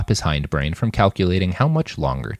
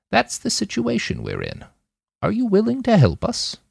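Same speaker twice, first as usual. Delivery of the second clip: higher voiced old lady asking. A narrator's reading. Nana Yazi asks Roland for help, in a higher old voice. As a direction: make it a high, elderly plea.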